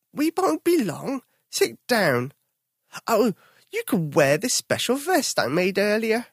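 a cheerful grandma bear asking Tom to judge. Acted. Grandma bear to Tom, high cheerful voice.

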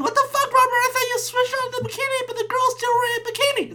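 high mock girlfriend voice, breaking into a laugh; girlfriend's voice. Girlfriend returning to find the bikini, high laughing mock voice. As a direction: play the girlfriend, exasperated and giggling.

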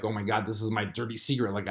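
ordinary delivery

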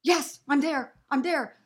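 breathless eager yes; excited yes. Retold or imitated. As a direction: jump at the chance. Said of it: "And I go".

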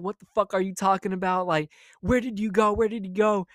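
imitating mom's angry interrogation. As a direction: demand answers like a furious mother. Mom yelling about where she went.

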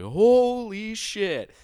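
high, slow drawn-out shocked yell; shocked friend. Retold or imitated. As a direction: stretch it out in disbelief. Friend sees dislocated arm.

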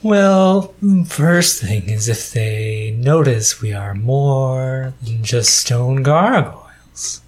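drawn-out, deliberate gargoyle voice; measured stone guardian, sinking in pitch. Acted. Gargoyle explains how it judges who is worthy, measured voice.

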